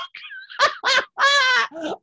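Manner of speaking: high-pitched burst of laughter; cracking up at your own story; long, squealing real laugh. Real, not acted.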